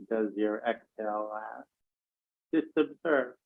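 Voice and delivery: calm, even meditation voice with long pauses; guided meditation. Real, not acted. Teacher guiding an online class to count breaths, calm and spacious. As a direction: lead breath counting gently, leaving space after each phrase.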